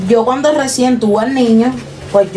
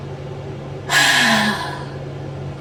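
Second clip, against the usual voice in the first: heavy sigh; 8 dB quieter than usual. Real, not acted. Weary sigh after overwhelming memories.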